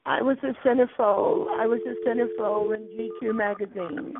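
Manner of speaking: high, sing-song camp "oh darling"; camp diva voice. Pretend. Teasing the caller in a high camp diva voice. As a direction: swoon it out like a vain diva.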